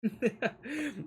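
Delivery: sudden high burst of laughter; bright laugh. Real, not acted. Laughs at chat message.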